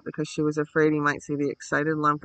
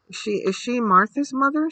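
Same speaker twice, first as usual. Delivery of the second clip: higher, hesitant stammered question; stammering; 7 semitones higher, 4 dB louder than usual. A narrator's reading. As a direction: stumble on the first words as you ask. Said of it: "she stammered".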